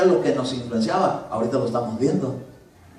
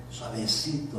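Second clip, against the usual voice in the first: much softer, lowered; soft and gentle; 4 semitones lower, 9 dB quieter, slower than usual. Shown as a demonstration. Preacher showing how the Holy Spirit arrives, very soft. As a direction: say it tenderly. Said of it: "llega así".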